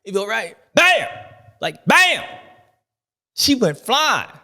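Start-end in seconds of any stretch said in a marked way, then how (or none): 0.7-2.2 loud, high imitated crash sound; loud mock impact noise; crash bam. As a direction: smack out the impact. Describing rider hitting the girl.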